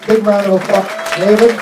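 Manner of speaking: loud, high showman shout; rally the crowd to cheer; announcer call. Real, not acted. Host calls for applause.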